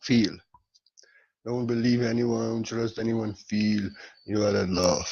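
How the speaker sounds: low, measured guru teaching; deep-pitched, earnest meditative delivery; calm spiritual guidance. Real, not acted. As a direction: guide listeners deliberately, with pauses.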